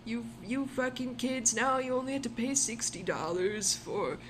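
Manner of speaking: put-on grumpy old timer voice; back in my day rant. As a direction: play a cranky senior ranting. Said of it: "I'm in my sixties".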